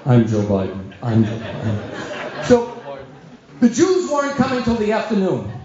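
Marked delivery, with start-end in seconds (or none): 0.1-2.5 low, deadpan self-introduction; deep-voiced politician impression; Joe Biden. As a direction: repeat it in a deep, dull mumble. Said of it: "walking around".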